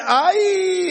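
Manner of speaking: put-on high cry of fright at the old pilot; mock scared cry. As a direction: yelp "Ay" like a terrified passenger. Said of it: "y usted lo ve y dice".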